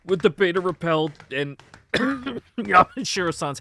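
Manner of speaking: choking back a cough. Real, not acted. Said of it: "I'm choking on my coffee".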